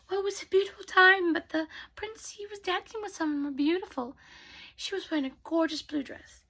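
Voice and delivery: high, lightly put-on girl's voice; snooty stepsister. Stepsister's gossip voice.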